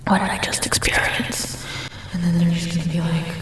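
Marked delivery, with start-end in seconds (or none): 0.1-1.6 acted whisper of disbelief; stunned mock whisper. Imitating people thrown by odd energy, stunned whisper. Acting out stunned listeners' reaction.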